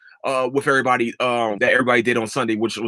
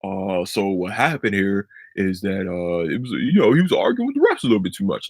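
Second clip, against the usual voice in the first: mocking a dull broadcaster, lower; monotone commentator impression; 5 semitones lower than usual. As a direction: drone it out like a bored announcer. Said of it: "like, a monotone voice".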